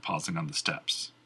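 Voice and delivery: ordinary delivery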